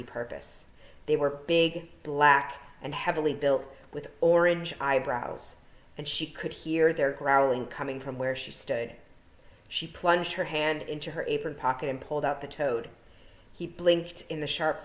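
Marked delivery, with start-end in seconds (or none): none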